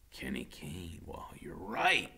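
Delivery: hushed, slow whisper. A narrator's reading. Iggy quietly recognizing Kenny, whispering. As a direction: breathe it out knowingly.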